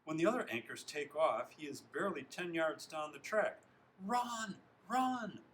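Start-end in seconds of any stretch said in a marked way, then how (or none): 4.0-5.4 high, loud urging shouts; scream. A narrator's reading. Hobbin urges lagging Zinkoff on, screaming. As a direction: scream it from behind a slow runner. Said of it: "Hobbin screams behind him".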